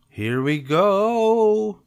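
high, loud, long-drawn GO; launching whoop. Acted. Cub takes feet off pedals downhill, whooping. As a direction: stretch "go" into a rising yell.

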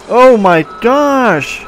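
high, loud, slow drawn-out shock; stunned exclaim. Real, not acted. Kid reacts to the crash in the game, stunned and slow. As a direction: stretch it out in disbelief.

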